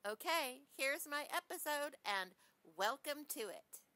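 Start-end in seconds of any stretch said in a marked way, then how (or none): none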